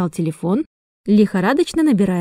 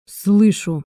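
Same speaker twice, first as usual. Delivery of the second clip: slightly dull, muffled voice; muffled, hollow reply. A narrator's reading. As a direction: answer with a touch of hollowness. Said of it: "глухо ответил Крейг".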